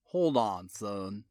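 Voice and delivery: low Tidewater drawl telling him to stop. Acted. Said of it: "Detective Boone said".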